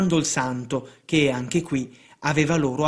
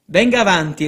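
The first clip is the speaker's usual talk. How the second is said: loud beckoning call, pitched higher; showman's call; 4 semitones higher, 7 dB louder than usual. A narrator's reading. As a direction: call him up before the crowd. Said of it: "gridò l'alienista".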